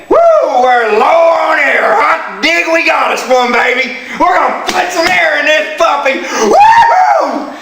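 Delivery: high whooping shout, laughing at the end; excited tire gauge. Acted. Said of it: "and it's gonna say".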